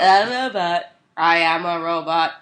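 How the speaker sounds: even machine gun syllables; flat robotic monotone, mock robot. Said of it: "And I sound like a robot".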